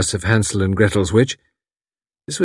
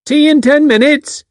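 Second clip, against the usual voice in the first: loud call to someone far away; 8 dB louder than usual. A narrator's reading. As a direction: call it across the garden. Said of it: "she called".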